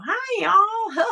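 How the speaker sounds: high country toy-bear voice; creepy talking bear. Retold or imitated. Host imitates the creepy Billy Bob Bear toy greeting, high country voice. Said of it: "it had a country accent like us".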